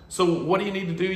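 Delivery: speaking around tooth tray; mouthpiece in mouth. Real, not acted. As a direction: lips on whitening tray.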